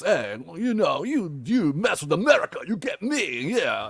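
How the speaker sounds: a light Belgian accent, imitating; lightly voiced Belgian accent on American lines. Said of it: "Belgian accent, and he talks like this".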